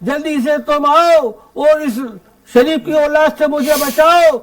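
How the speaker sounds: lilting, declaimed humorous verse; comic poem recitation. Acted. Poet telling the Holi mishap to the audience, comic verse recitation. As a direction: recite in measured lines for a live crowd.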